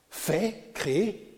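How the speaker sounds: ordinary delivery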